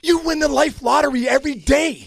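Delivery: high, loud motivational punchline; rousing proclamation. Real, not acted. Dad firing up his kids every morning, rousing proclamation. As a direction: proclaim it like a rallying cry.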